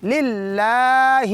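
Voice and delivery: very slow للہ with the long vowel stretched; lengthened lam. Shown as a demonstration. Teacher stretching the word for students, very slow. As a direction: draw out the ل sound in للہ. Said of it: "لام کو تھوڑا لمبا کریں گے".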